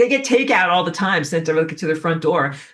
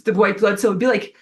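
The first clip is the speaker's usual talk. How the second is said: ordinary talk, nothing marked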